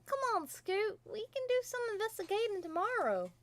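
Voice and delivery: high, loud and urgent; eager insisting. Acted. Scootaloo eager to keep investigating with her friends. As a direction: push them on, keen and high. Filly urging friends to continue.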